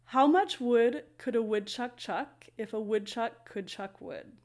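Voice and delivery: slowed down and careful; slow tongue twister. Shown as a demonstration. Teacher trying the woodchuck tongue twister, slowly. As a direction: ease through each chuck without rushing. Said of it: "I was saying it quite slowly".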